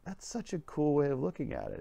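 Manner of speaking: high-pitched, mildly gushing reply; delighted admiration. Retold or imitated. Adult admiring a little girl's idea, mildly delighted. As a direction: pitch it up with a touch of wonder.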